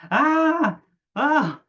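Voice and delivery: sudden loud high roar to scare; startling boo. Shown as a demonstration. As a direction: jump out and yell Ah at someone. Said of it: "I'll try to scare them like this".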